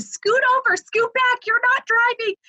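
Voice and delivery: high, bossy yell; imitated yelling. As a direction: bark orders in a high panic. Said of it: "yelling at me".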